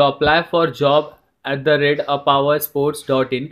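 reading an email address out slowly, word by word; slow dictation. Shown as a demonstration. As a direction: spell it out slowly so they can note it down. (धीरे-धीरे email ID बोलकर लिखवाना)

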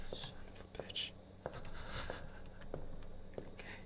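faint whispered curse. Real, not acted. Hiding gamer cursing in a whisper.

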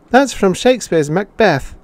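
put-on high, bright child's answer; eager pupil. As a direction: pipe up like a schoolboy.

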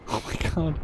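breathy laugh. Real, not acted. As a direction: laugh in disbelief. Camera shake.

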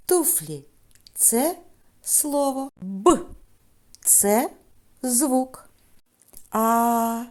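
teacher leading a word game; classroom game. Acted. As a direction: call out each item and label it. Game calling sounds and words.

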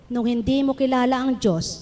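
ordinary talk, nothing marked

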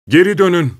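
hands cupped, yelling to them; shouting. A narrator's reading. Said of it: "diye bağırdım". (korkuyla bağırış)

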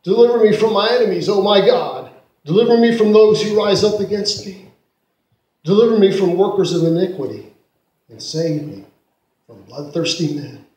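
loud, urgent pleading prayer. A narrator's reading. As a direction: cry the verses out for help. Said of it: "but she read louder".

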